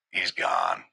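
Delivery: low, understated delivery of a death; grave news. Retold or imitated. Voicing brother's silent nod of death.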